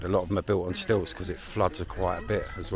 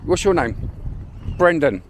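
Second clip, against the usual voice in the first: calling out; 9 semitones higher, 5 dB louder than usual. Real, not acted. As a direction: speak up clearly so they understand. Calling to children, asking names.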